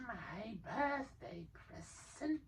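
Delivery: high, soft, slow creature murmur; soft high creature croon; Gollum whispering. A narrator's reading. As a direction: croon to himself. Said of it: "he whispered to himself".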